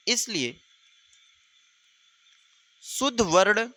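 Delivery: teacher dictating notes word by word; dictation. Shown as a demonstration.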